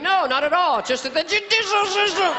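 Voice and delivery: high-pitched slurred denial; hammed-up tipsy witness; mock drunk. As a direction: protest sobriety while slurring "judicial system". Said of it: "Have you been drinking?".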